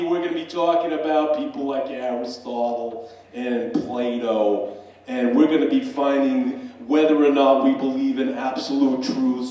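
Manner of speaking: loud Boston-accented lecture, easing down; bar-room accent. Shown as a demonstration. Boston-accented lecturer welcoming students to Intro to Philosophy, loud broad accent. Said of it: "that accent".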